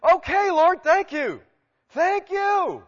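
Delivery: high, loud cry of thanks; acted grateful outburst. Paul thanking the Lord for protection, loud and elated. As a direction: belt out the thanks, drawing out the last.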